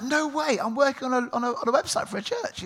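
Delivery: high, excited no way; surprised woman. Retold or imitated. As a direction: play her surprise brightly. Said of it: "she's like".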